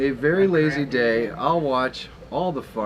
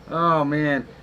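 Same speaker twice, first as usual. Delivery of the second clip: ordinary talk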